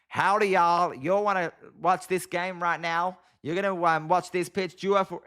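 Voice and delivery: attempted American accent sliding into a Southern twang; bad American accent. Shown as a demonstration. Streamer obliging a request for American, badly faked Southern accent. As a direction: put on a clumsy Southern-sounding American accent. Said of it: "if I try and do an American accent, it starts sounding southern".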